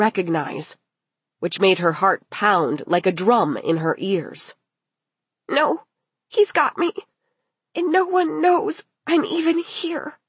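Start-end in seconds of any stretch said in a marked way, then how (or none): 5.5-10.2 high, frightened voice; panicked girl. Acted. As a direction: think aloud in high-pitched panic. Girl's panicked thought in strange room.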